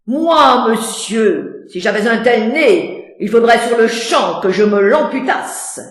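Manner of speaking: loud, belligerent challenge; aggressive. Acted. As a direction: pick a fight with every word.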